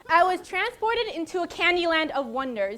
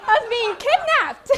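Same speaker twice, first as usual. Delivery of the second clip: laughing; 7 semitones higher, 8 dB louder than usual. Real, not acted. Panic joke mid laugh.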